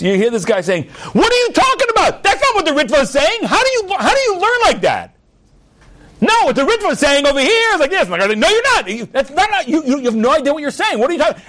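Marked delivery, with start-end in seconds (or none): 1.1-5.1 high-pitched acted yell in a study quarrel. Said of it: "You hear the sounds of yelling and screaming". 6.2-8.1 high, heated acted rebuttal; yelling. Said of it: "the sounds of yelling and screaming". 8.3-11.4 high, rapid-fire quarrelling; mock screaming argument. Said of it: "they're arguing, they're screaming, they're yelling at each other".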